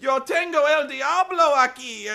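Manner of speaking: high, loud hammed-up Spanish; mock Spanish. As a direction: belt it like a panicked cartoon maid.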